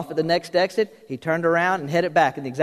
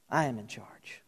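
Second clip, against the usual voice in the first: hushed, lower whisper of "I am in charge"; God whispering his assurance; 8 semitones lower, 12 dB quieter than usual. Acted. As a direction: breathe the words, intimate. God's voice repeated as whisper.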